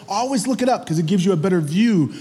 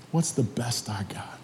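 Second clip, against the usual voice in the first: low, hushed self-questioning; musing to oneself; 8 semitones lower, 11 dB quieter than usual. Acted. Mary wondering what best she has for Jesus, musing quietly. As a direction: drop to a soft, private pondering. Voices Mary pondering to herself.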